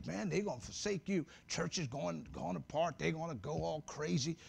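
low, soft devil's taunt; devil whispering. Retold or imitated. Preacher voicing the devil taunting him, soft and low. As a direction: hush it like a tempter in the ear. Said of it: "Devil start telling you".

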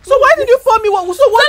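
high, loud, fast outburst; angry demand. Real, not acted. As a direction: fire the question back furiously. Angry at being doused with water.

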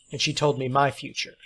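ordinary delivery

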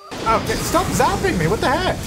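high, loud startled outburst; startled protest. Real, not acted. Gamer protesting at a gnome zapping her sim, startled and loud. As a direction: yelp at being zapped.

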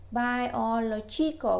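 Biological said slowly with the LO syllable stressed; slow teaching model of Biological, weight on LO; stress on the middle syllable. Shown as a demonstration. Teacher showing Vietnamese learners the stress in Biological, slow. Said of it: "nhấn cái trọng âm ở chính giữa ngay chỗ chữ lô".

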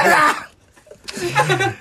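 put-on old man shrieking again; shrill shout. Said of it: "そう叫ぶ".

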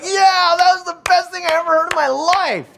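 hammed-up high crowd reaction; mock crowd voice. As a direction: squeal it like a starstruck fan. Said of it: "everyone's like".

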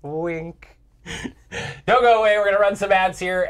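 loud, high TV host announcement; announcer voice. Acted. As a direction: hype up the break like a host. Host voice going to break.

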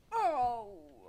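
sharp loud ow; mock pained yelp. Real, not acted. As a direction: yelp as if hit. Hurt in game.

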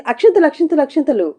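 calling for the rice at the wedding; shouting. A narrator's reading. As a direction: cry out the word over and over. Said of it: "గట్టిగా అరిచింది శశిక".

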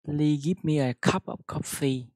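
careful model sentence; loud, slow teacher demo; loud slow reading. Shown as a demonstration. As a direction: read Please give me a cup of coffee loud and slow. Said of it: "Đọc ra cái chữ".

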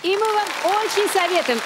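ordinary delivery

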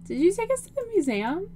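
high drawn-out surprise; surprised. Real, not acted. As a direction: stretch it out, amazed. Surprised at new location.

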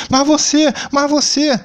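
high, whiny, sing-song protest; mocking whine. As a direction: parody a whiny complainer. Mocking complaining viewers.

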